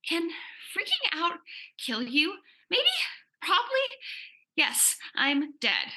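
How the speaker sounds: high, jumpy panic; panicked. Acted. Girl freaking out at her own party, high and panicky. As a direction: spiral into comic panic.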